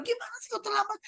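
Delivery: high-pitched, mock irritated scold. Boss scolding everyone around him for being late, mock irritated. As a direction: imitate a boss snapping about lateness. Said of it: "dia marah-marah".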